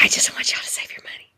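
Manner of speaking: unvoiced conspiratorial whisper; hushed confiding whisper. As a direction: lean in and whisper the secret. Confiding aside to class.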